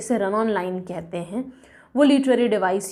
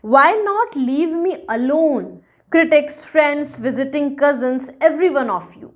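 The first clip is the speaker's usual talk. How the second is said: slow, emphatic verse reading; deliberate poem reading; poem recitation; 3 semitones higher, 3 dB louder, much slower than usual. A narrator's reading. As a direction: recite slowly, setting each phrase apart. Reads the poem aloud.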